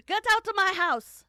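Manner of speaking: high, very loud hammed-up yell; mock furious shout. Voicing the cook ejecting the investigator, mock furious shout. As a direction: blow up into an over-the-top scream. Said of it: "that's not what she sounded like".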